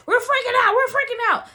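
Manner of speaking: high, loud cartoon panic; freaking out. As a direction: shriek it like a panicking cartoon character. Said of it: "I was totally freaking out".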